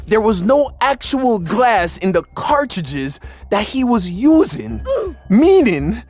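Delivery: high-pitched, worked-up disbelief; animated, high storytelling of a shock twist; incredulous reveal. Real, not acted. Incredulous reveal of the empty cartridges.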